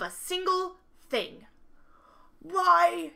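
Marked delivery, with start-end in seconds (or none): 2.4-3.1 loud high outburst; cried out. Acted. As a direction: burst out in frustration. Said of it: "he cried".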